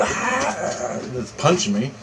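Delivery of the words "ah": high, soft monster wail; imitated demon cry. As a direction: mimic the creature's cry, held back. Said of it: "And this thing's going".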